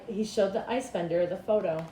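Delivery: ordinary delivery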